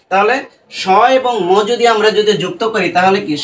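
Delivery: ordinary talk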